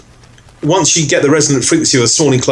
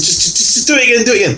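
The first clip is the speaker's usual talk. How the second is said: raised-pitch pleading to continue; eager urging; 8 semitones higher, much faster than usual. Retold or imitated. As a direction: push him to keep going. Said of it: "He says".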